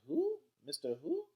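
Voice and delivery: high, loud disbelieving question; startled question. A narrator's reading. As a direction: pitch it way up in alarm. Mama startled by unknown name.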